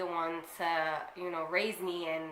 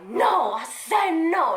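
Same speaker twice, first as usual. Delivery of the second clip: higher, louder, slow firm no; defiant yell; 9 semitones higher, 9 dB louder, slower than usual. Pretend. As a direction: stand up to dad and shout it. Imagined defying her dad.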